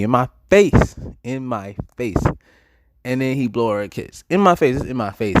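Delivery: high, punched outrage on "face"; indignant emphasis. Real, not acted. Storyteller recounting a rival disrespecting him to his face, indignant. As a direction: hammer the repeated insult in disbelief.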